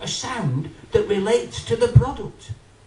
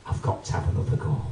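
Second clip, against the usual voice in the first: whispered eager aside; 7 dB quieter, faster than usual. Acted. Shopper's eager inner voice.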